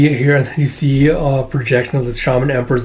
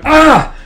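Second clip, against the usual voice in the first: short, high yell on impact; acted cry of pain; 6 dB louder than usual. Game master voicing a blast hit, short high cry. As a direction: yelp as if struck mid-battle.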